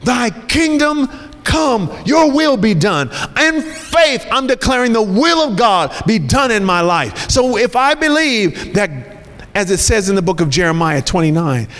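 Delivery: rising, ringing pulpit preaching; fervent sermon delivery building over music; preaching at full force. Acted.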